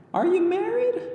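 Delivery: put-on very high, loud, blunt child; curious little girl asking boldly; girl character voice. A narrator's reading. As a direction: blurt out the nosy question. Girl asks boy.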